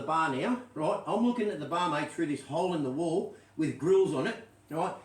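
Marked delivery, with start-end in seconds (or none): none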